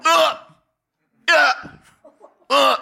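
short, high mock gasps, spaced apart; imitated startled grunts. Preacher imitating the watchers who aren't Christians, startled noises. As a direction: imitate onlookers with high little "uh" sounds. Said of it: "they're going".

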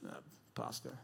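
barely audible, voiceless admission; embarrassed mumble. Retold or imitated. Young self embarrassed to admit job.

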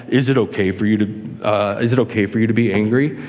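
lightly voiced deep divine question, only a little booming; bad James Earl Jones impersonation. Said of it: "imagine, like, James Earl Jones speaking, like, in this deep, like, booming voice".

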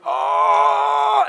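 sudden high yelp of pain; pained cry. Retold or imitated. Imitating son hit by ball, loud pained oh. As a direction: cry out like a kid hit in the gut.

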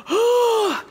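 loud, high mock gasp; exasperated gasp. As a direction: gasp up high, imitating an overwhelmed parent. Acts exasperated reaction to her son.

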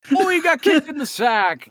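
mock commentator.

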